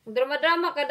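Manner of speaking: mock nagging; mock scolding. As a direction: scold yourself in jest, loud and high.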